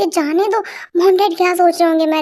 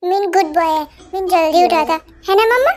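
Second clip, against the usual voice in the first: put-on small child voice; 3 semitones higher than usual. Little boy teasing his aunt in a childlike voice. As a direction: play a cheeky little kid showing off.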